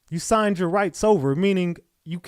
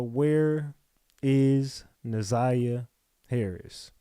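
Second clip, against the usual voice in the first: solemn slow question; 4 semitones lower, much slower than usual. Real, not acted. Host closes with a solemn question.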